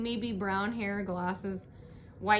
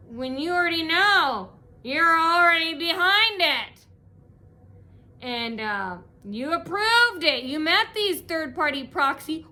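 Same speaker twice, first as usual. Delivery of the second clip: louder, higher, slow accusation; angry venting; 5 semitones higher, 6 dB louder, slower than usual. Real, not acted. Venting at the owners, loud and accusing. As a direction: drag out the blame word by word.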